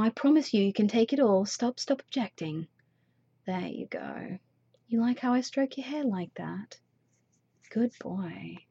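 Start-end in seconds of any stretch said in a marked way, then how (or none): 1.5-8.6 fading into a hushed, soothing whisper; soft whisper. Acted. Soothing her partner at the end, fading whisper. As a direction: let it sink to a calm, quiet whisper.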